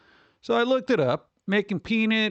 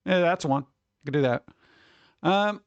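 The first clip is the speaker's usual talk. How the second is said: ordinary delivery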